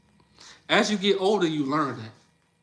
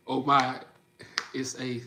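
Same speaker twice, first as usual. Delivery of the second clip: normal talking voice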